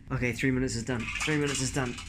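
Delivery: ordinary delivery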